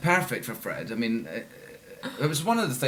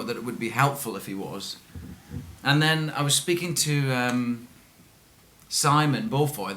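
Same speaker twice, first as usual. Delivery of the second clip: ordinary talk, nothing marked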